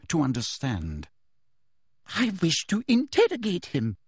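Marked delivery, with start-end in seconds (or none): 2.2-4.0 high, insistent demand; angry. A narrator's reading. Umbridge insisting to Snape, angry and high. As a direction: push her will through. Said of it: "repeated Umbridge angrily".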